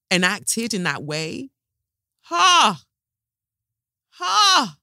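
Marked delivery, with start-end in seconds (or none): 2.2-4.8 two sharp high laughs in disbelief; a short appalled high laugh; bitter disbelieving laugh. Real, not acted. Disbelief at the enacted accounts.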